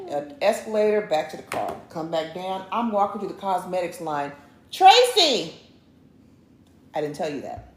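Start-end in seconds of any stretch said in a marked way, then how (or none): 4.7-5.5 loud, high call across a store. Retold or imitated. Shopper calling to a clerk across cosmetics, acted loud call. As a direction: holler the name to get attention.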